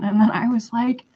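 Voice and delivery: ordinary delivery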